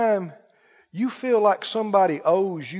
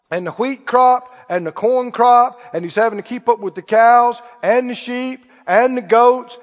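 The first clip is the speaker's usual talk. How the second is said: usual speaking voice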